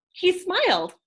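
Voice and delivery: delighted gasp. A narrator's reading. The big moment of the story.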